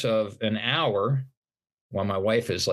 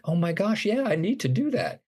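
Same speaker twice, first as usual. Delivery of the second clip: retold burst of excitement, jumping much higher in pitch; sudden excitement; 10 semitones higher than usual. Recalling his reaction to the pastor, going much higher with excitement. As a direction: flash of eager realization.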